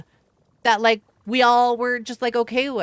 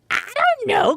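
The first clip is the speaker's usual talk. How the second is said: put-on child self; 14 semitones higher, 4 dB louder than usual. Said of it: "And I'm just like".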